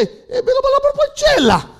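very high, loud imitation of a local woman. Guatemalan woman gives directions to a driver, imitated high-pitched speech. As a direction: squeal out a rapid, garbled direction. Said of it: "ella habló como habló".

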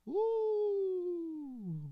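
long, high, soft woo; high whoop. Real, not acted. Preacher whooping between readings of his point, high drawn-out woo. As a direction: let out a drawn-out high "woo" that falls away.

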